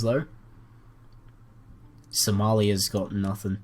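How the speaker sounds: ordinary talk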